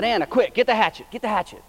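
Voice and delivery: high, loud urgent call for the hatchet; urgent shout. Retold or imitated. Father shouting to his daughter for the hatchet, urgent and loud. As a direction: yell at her to hurry. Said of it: "and I said".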